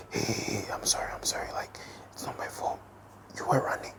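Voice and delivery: hushed whispered apology; whispering. Retold or imitated. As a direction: whisper it urgently so no one hears. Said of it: "unvoiced whisper".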